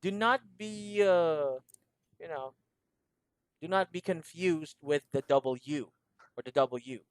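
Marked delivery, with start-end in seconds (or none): none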